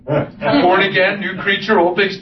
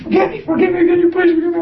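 high, urgent begging; imitated pleading; 9 semitones higher, faster than usual. Husband mimics wife begging his forgiveness, high urgent pleading. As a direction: beg over and over in a raised pitch. Voices wife's frantic plea.

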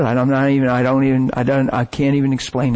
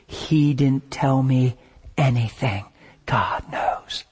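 slow, sinking to a hushed whisper; fading to whisper; 2 semitones lower, 3 dB quieter, slower than usual. Real, not acted. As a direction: drop your voice to a secret. Secret confided, fading to whisper.

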